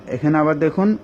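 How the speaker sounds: ordinary talk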